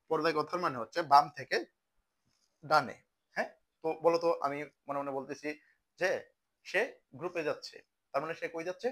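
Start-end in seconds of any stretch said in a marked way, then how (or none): 0.1-2.9 বাম থেকে ডানে called out louder; loud drill answer. Shown as a demonstration. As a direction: hammer the answer home to the class. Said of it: "আর পর্যায় কথার মানে কি?". (জোরে বলা উত্তর)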